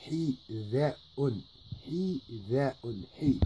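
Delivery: Hi, da'un said twice with throaty hi; repeated drill. Shown as a demonstration. Teacher drilling Hida'un for learners, repeated. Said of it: "tu prononces".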